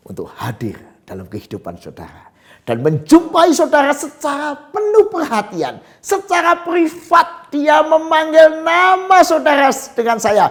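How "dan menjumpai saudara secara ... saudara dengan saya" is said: high, loud preaching at full force; soaring pulpit voice, proclaiming; fiery preaching. Acted. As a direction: preach it out with rising fire.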